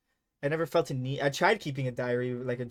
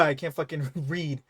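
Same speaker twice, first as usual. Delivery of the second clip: normal talking voice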